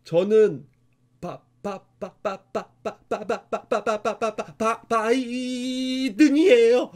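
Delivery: stuttering, stretching out the last word; an imitated stammer on 바-, 바-; imitating a child's stutter. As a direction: repeat the first syllable over and over, then stretch out the name. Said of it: "진짜 이렇게 했었대요".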